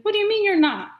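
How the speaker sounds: loud, high-pitched disbelieving question; incredulous challenge. Retold or imitated. Friend challenging the Caribbean woman's denial, incredulous. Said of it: "He's like".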